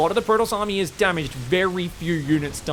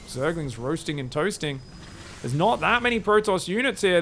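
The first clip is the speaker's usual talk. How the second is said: ordinary delivery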